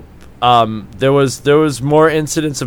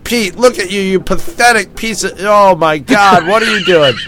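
higher, mock-appalled telling-off; raised, incredulous mock rebuke; exasperated scolding; 4 semitones higher than usual. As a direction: act out scolding a drunk friend. [name] scolding drunk Pete.

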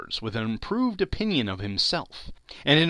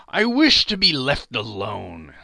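slow, louder firm refusal; curt refusal; 8 dB louder than usual. Acted. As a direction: shut them down flatly. Scrooge rebuffs the gentlemen.

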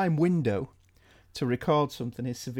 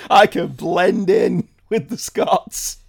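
loud, slow mock-Scottish; Scottish accent; 3 semitones higher, 7 dB louder, much slower than usual. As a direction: ham up a bad Scottish accent. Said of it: "It's like my Scottish".

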